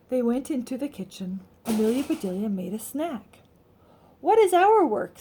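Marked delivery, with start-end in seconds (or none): 4.2-5.2 put-on high, loud, curious kid; young girl asking, higher and louder; child's eager question. A narrator's reading. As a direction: ask it like an excited little girl. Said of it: "said Angela".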